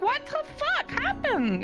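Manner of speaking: high-pitched incredulous cry; bewildered outburst. Retold or imitated. Recounting a friend turning on her suddenly, incredulous. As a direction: shoot up high in exasperated disbelief. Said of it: "It's like".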